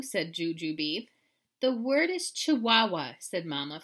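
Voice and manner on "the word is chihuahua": mildly frazzled, a bit higher and louder. A narrator's reading. Mama corrects her daughter, mildly frazzled. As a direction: correct with a touch of strained patience.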